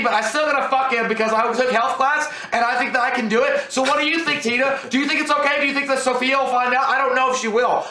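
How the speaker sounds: high-pitched gossiping teen girl impression; mock a schoolgirl prattling on without a break; chattering high-school girl. Moviegoer imitates two girls gossiping in the theater, high chatter. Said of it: "Then all of a sudden they're like".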